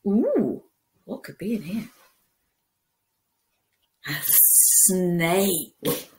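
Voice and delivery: plain reading voice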